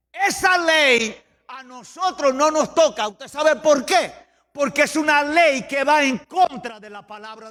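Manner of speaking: impassioned, insistent sermon delivery; full-force preaching. Real, not acted. Preacher rejects the law before his church, full-force preaching. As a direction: drive it home to the whole church.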